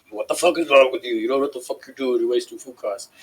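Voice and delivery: mildly heated imitation of a ranting boss; mock angry scolding; retold freak-out. Supervisor scolding cook over burnt bread, imitated freak-out. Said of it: "he would freak the fuck out".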